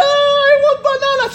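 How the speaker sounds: hammed-up childish cry for a banana. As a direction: wail it like a crying little boy. Crying child in story.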